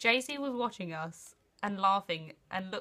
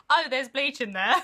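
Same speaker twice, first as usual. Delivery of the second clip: laughing; 4 semitones higher, 8 dB louder than usual. Real, not acted. Retold while laughing.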